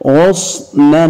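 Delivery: غصنا as ghus-nan; fatha tanween. Shown as a demonstration. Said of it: "عند تنوينها بالفتح".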